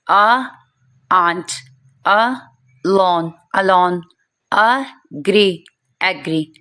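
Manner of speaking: slow teacher drill, आ before आंट; A sound drill. Shown as a demonstration. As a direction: sound out अ, then लॉन, then अलोन in pieces. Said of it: "इसकी आवाज़ आ करके निकल रही है".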